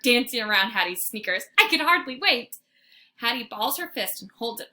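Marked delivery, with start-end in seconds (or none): 1.6-2.6 louder, higher excited line; can't stand still; excited dog; eager puppy. A narrator's reading. Fenway excited.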